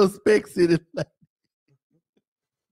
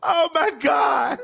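hysterical laugh; 6 semitones higher than usual. Real, not acted. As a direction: laugh till you can't breathe. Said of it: "Why are you laughing so hard?".

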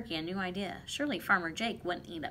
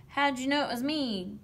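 higher, mild storybook howl; 5 semitones higher, 4 dB louder than usual. A narrator's reading. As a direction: howl it lightly as the dismayed turkey. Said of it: "howled Turkey".

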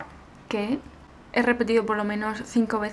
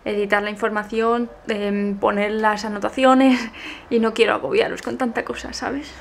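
ordinary talk

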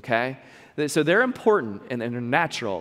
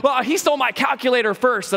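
put-on defensive kid; 7 semitones higher, 4 dB louder than usual. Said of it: "the other kid will be like".

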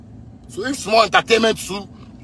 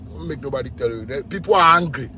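normal talking voice

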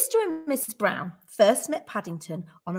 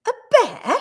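high, loud startled question; astonished Mrs Brown; incredulous echo; 10 semitones higher, 7 dB louder than usual. A narrator's reading. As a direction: repeat it in high-pitched disbelief. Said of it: "repeated Missus Brown".

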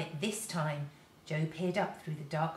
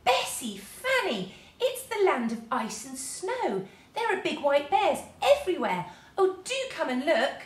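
high, louder excited calling; excited shout; 8 semitones higher, 5 dB louder than usual. A narrator's reading. Jo calling the girls from the top of the tree, excited. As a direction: call the girls over with excitement. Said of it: "He gave a shout".

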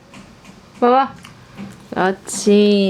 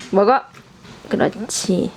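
ordinary talk, nothing marked

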